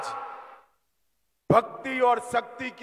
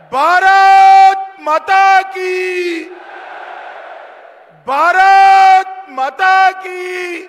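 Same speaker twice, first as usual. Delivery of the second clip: very slow, loud, high slogan shout; booming political rally speech; 6 semitones higher, 9 dB louder, much slower than usual. Real, not acted. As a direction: stretch out the chant for the crowd. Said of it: "प्रचंड आवाज से बोलिए".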